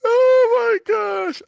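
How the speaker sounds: drawn-out high shouted oh my gosh; surprised exclaim. Real, not acted. Host reacting to co-host's joke, slow high shouted surprise. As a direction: let it burst out slowly in disbelief.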